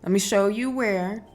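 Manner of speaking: ordinary talk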